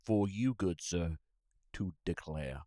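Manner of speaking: slow, grand Southern gentleman drawl; mock Southern drawl. Answers his buddy's bit in a slow mock Southern drawl. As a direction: grant permission like a courtly Southern host.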